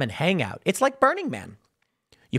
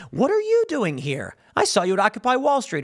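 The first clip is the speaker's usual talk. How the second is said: higher-pitched retold challenge; mock surprise; 7 semitones higher than usual. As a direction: act out incredulously confronting someone you recognize. Acts out confronting a recognized protester.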